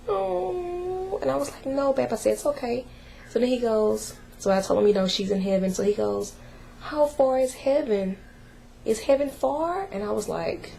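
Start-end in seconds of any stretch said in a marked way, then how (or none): 0.1-1.2 put-on much higher, small boy's disappointed oh; son's little oh. Son's reaction, voiced by mother. 1.7-2.9 put-on higher, gentle mother soothing a small boy; comforting child. Mother comforting her son. 6.8-9.9 put-on higher, small boy asking, innocently; a hint of a puzzled little boy's voice; son's innocent question.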